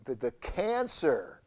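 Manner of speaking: ordinary talk, nothing marked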